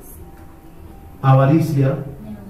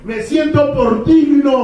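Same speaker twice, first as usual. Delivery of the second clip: mock fervent Peter; 8 semitones higher than usual. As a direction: drag out gloria like a proud zealot. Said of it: "Antes al Pedro dijo".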